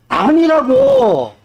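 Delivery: high, long-drawn exasperated cry; stretched-out, high-pitched insistence; exasperated rebuttal. Real, not acted. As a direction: drag it out high, refusing the excuse. Preacher emphatically rebuts a congregant's excuse.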